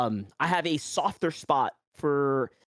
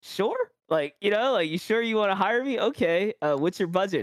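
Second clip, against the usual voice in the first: incredulous disbelief; 5 semitones higher than usual. Retold or imitated. Retelling his reaction to a hiring offer, mock incredulous. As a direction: pitch jumps way up on "sure", half-laughing doubt. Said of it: "And I'm like".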